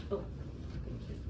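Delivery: usual speaking voice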